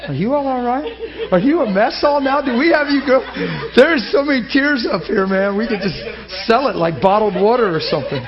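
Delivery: high, loud, laughing call to the crowd; rally the weeping crowd with a laugh; excited joking. Real, not acted. Teasing tearful congregation.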